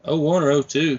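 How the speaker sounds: ordinary delivery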